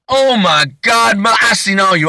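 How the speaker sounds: hammed-up starstruck exclamation, pitched high; gushing fan. Suspect telling the star he's seen his movies, high excited gush.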